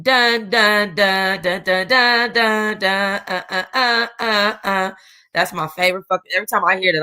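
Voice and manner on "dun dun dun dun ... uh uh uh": hummed dramatic intro. Retold or imitated. Host hums the episode's opening music to viewers, playful imitation. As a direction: mimic the suspense music with dun dun. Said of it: "start with a ... dun".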